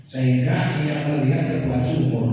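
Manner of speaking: suara keras dan tegas, menekankan setiap kata; membaca ayat dengan lantang. Acted. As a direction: proklamasikan ayat seperti di mimbar. Pastor reading scripture emphatically. (pendeta membacakan kisah Hagar kepada jemaat dengan lantang)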